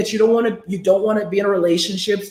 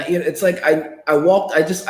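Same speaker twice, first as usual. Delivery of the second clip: normal talking voice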